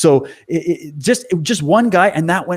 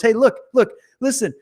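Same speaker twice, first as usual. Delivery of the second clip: high-pitched imitation of a man making his case; insistent retold speech in a high voice; assertive address; 8 semitones higher than usual. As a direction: mimic him demanding attention, pitched up. Mimics man addressing cops on camera.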